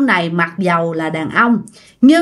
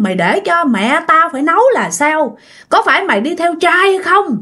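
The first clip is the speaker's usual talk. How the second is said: raised-pitch angry interrogation; angry scolding; 6 semitones higher than usual. A narrator's reading. Drunk husband berating his wife for coming home late, angry scolding. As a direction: scold her in a string of accusing questions. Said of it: "vừa đánh vừa chửi quá trời luôn".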